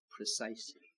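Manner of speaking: low, hushed single word; soft echo. Acted. Preacher answering his own question, softly. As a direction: let the answer settle quietly.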